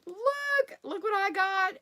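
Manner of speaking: high, thrilled look-what-I-got; excited show-off. Retold or imitated. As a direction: show off the new product, voice jumping up. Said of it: "I was real excited about a product".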